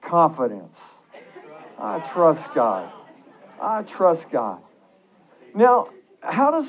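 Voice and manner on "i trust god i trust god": firm, slow declaration; more confident. Shown as a demonstration. Preacher leading the congregation to repeat it, confidently. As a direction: say it slowly with conviction. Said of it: "Say it with a little more confidence".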